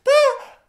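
short high-pitched acted scream. A narrator's reading. Katya screams as she hits the water, short shriek. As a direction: let out a sudden loud, high shriek. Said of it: "she finally screamed".

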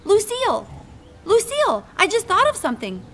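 high, eager and bubbling over; excited. Acted. Junie B. bursting with her twin idea to Lucille, excited. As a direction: burst out with a brand-new idea. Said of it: "my whole face got happy".

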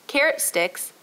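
ordinary delivery, nothing marked

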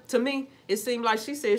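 usual speaking voice

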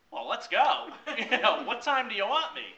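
high, loud and laughing; eager laughing. Retold or imitated. Eager to start the new job, high and laughing. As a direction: jump at the offer, laughing.

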